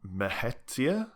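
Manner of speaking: "Mehetia" sounded out slowly, rising like a question; tentative, drawn-out "Mehetia" with questioning rise; unsure pronunciation attempt. Shown as a demonstration. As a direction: try out "Mehetia" slowly, unsure, pitch lifting at the end. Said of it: "not sure how that's meant to be pronounced".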